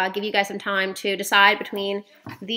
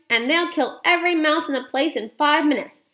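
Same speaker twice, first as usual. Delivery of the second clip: excited cry; 6 semitones higher than usual. A narrator's reading. Boy eagerly explains the cat plan, excited cry. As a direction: cry it out, carried away. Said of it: "I cried".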